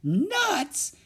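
very high exasperated exclamation; high, sharp outburst; exclaiming. A narrator's reading. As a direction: burst out with it, pitched high. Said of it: "exclaimed Leffy".

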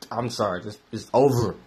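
ordinary delivery

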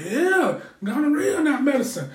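high, loud mock nurse. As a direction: play the nurse dead serious. Nurse confirming absurd claim.

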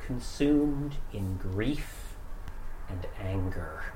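somber narration. A narrator's reading. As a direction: wind the story down gravely. GM closing narration.

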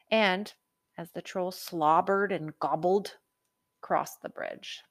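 usual speaking voice

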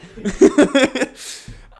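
real laugh breaking out, high and loud; giggling fit; high burst of laughter. Real, not acted. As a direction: crack up at the memory. Laughs at own story.

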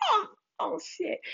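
high, hushed startled curse; high quiet stunned swear; awed shock. Retold or imitated. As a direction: gasp it out, caught off guard. Hawk spreads its wings.

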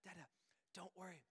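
imitated toddler's soft reassurance; hushed little-kid voice; small child. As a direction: explain it softly like a three-year-old. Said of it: "He says".

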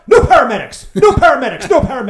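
repeated panicked shout, high and loud; retold frantic refusal, shouted high; panicked yell. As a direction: imitate him yelling in protest, over and over. Friend panics about paramedics coming.

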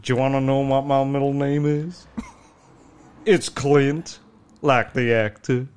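slow, drawled American cowboy voice; lightly done cowboy accent, slow and proud; mock Texan. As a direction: play the self-important Texan. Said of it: "He said".

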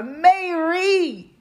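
high, drawn-out call of her name; Jesus calling Mary. Acted.

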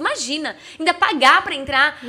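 ordinary delivery

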